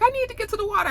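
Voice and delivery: high, desperate plea; desperate grandmother. Retold or imitated. Feverish grandmother pleading to be taken to the water, high and desperate. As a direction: cry out for the water.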